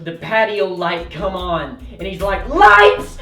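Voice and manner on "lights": high, loud warning cry; mock panicked warning; alarmed shout. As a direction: yell the alarm to run. Friend warns them again.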